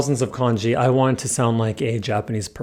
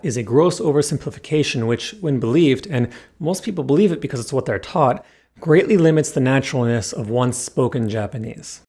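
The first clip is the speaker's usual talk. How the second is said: ordinary delivery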